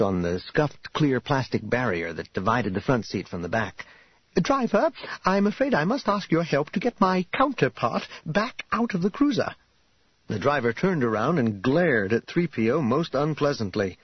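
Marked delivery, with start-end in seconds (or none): 4.3-9.5 high-pitched, fussily courteous robot; prim, polite droid. Acted. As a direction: ask for help in a high, formal droid voice. Voices fussy droid Three P O.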